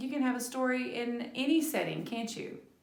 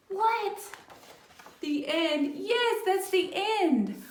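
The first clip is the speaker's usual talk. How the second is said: high, loud, drawn-out mock shock; teacher hamming surprise at the ending; 9 semitones higher, 4 dB louder, slower than usual. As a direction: play up disbelief for the kids.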